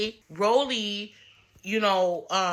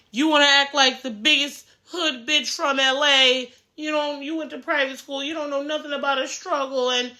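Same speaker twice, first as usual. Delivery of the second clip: higher put-on voice of a ranting rival; hammed-up Anna lecturing Scotty; mocking Anna's rant; 7 semitones higher than usual. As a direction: sneer it out like the cast member you're mocking. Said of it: "Anna starts going on this spiel, this rant".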